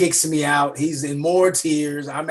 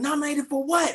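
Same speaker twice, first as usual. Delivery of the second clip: confused; 13 semitones higher than usual. Retold or imitated. Quoting himself confused.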